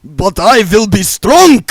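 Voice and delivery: high, slow, very loud scream; vampire screaming his resolve. Acted. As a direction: shout out his vow. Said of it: "he screamed".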